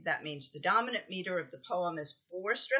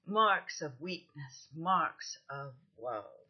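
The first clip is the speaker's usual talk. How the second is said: slow, measured iambic reading, each stressed syllable drawn out; scanned verse reading; slower than usual. Shown as a demonstration. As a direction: recite the stanza slowly foot by foot, falling away on "marks of woe". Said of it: "the full scansion of this stanza".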